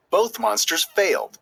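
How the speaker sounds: ordinary delivery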